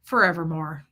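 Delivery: lightly imitated low voice, falling tune; low, falling murmur of wonder; Fred's catchphrase. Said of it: "he would do this. He'd go".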